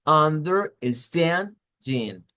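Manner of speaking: understanding very slow, stand pushed up and held; slow teaching read of understanding; stress on stand. Shown as a demonstration. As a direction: spell out understanding syllable by syllable, leaning on stand. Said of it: "One more time".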